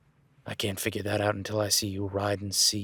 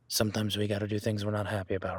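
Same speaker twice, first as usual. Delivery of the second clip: usual speaking voice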